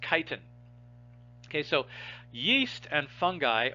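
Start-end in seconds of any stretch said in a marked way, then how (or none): 0.1-0.4 chitin said "KY-tin"; hard K pronunciation. Shown as a demonstration. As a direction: start chitin with a K sound, never ch. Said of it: "So you pronounce this word as".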